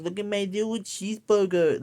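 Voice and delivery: put-on high baby voice, wittle cheeseburger; mocking an adult woman's baby talk; mock cutesy baby talk. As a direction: squeal it like a toddler with a toy. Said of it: "she's like".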